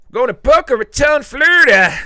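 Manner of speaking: loud high announcer shout; excited announcement. Acted. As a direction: trumpet the place name like a game show. Excited reading of super chat.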